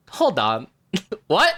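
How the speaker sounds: sudden high confused What; surprised. Retold or imitated. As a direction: stop short, then a baffled laugh. Reacting to the badger death.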